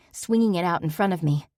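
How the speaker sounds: ordinary delivery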